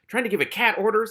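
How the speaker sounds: high, loud scoffing disbelief; indignant. A narrator's reading. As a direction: scoff at the very idea. Cat's indignant inner thought.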